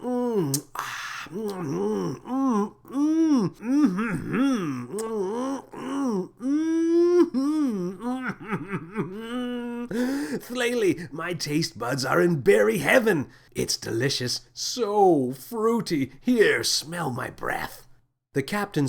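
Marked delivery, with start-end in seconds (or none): 0.0-9.9 ecstatic mmm. Acted. Captain moaning in bliss at the berry taste, high and ecstatic. 10.4-17.9 high, strange alien captain voice, slow on the end; giddy captain. Acted. Captain gushing to Thlaley about the berries, high and giddy. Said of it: "turned to Thlaley and said".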